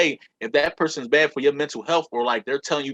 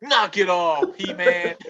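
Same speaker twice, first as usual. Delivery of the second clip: high, hammy cartoon villain sneer; Skeletor; 5 semitones higher, much slower than usual. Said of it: "it sound like Skeletor".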